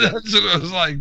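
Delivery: laughing through the words. Real, not acted. Laughing while retelling.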